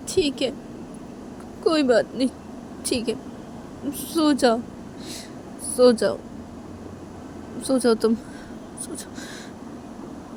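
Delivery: halting, choked-up acceptance; tearful. Acted. Shanaya tells Tisha to sleep while crying, tearfully. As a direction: give in through tears, fading to almost nothing. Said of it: "रोते रोते ऐसे बोलती है".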